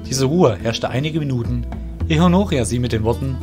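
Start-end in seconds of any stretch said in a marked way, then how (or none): none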